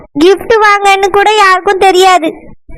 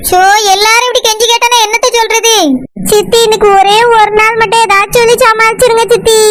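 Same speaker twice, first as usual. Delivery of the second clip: ordinary talk, nothing marked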